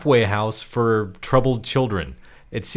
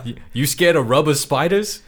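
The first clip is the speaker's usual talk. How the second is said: higher teasing question; taunting kid; 4 semitones higher than usual. Acted. Co-host playing Reggie teasing about spiders, taunting. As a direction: needle him like a little prankster. Voices the taunting prankster child.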